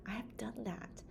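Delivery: soft breathy confiding whisper; whispered aside. Real, not acted. Confiding her clinic success to viewers in a whisper. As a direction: let them in on a secret.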